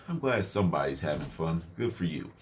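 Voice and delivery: quiet, low murmur; soft murmur. Retold or imitated. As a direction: murmur it to yourself. Quiet inner remark.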